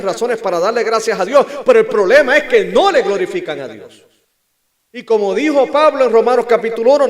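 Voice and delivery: high, loud sermon voice; full-force preaching. Acted. Preacher citing Paul on glorifying God, full-force preaching. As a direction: proclaim it to the whole congregation.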